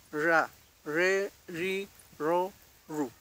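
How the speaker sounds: RA, RE, RI, RO, RU with untrilled r, evenly spaced; Mapuche r drill. Shown as a demonstration. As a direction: drill RA, RE, RI, RO, RU for learners. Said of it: "Esa es la forma entonces de pronunciar".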